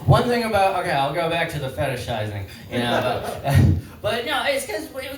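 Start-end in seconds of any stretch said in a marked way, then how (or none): none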